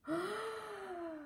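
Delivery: sudden high startled gasp; sharp high gasp. A narrator's reading. Amelia realizing Norman is a bear, high gasp. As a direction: gasp at the discovery.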